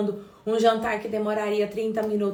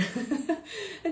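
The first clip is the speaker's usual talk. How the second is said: embarrassed laugh; 7 semitones higher, 6 dB quieter than usual. Real, not acted. Laughs at her audio mistake.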